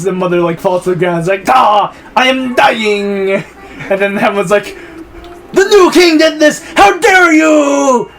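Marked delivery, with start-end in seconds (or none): 1.5-3.3 slow, hammed-up death wail; mock dying cry. Mother falling poisoned, theatrical dying cry. 5.5-8.1 high, hammed-up cry of accusation; mock furious shout. Hamlet accusing the new king, theatrical outraged shout.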